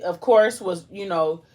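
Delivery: usual speaking voice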